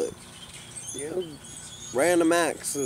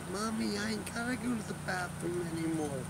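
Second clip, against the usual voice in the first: put-on small child voice; 6 semitones higher, 12 dB quieter than usual. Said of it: "this damn four-year-old kid say".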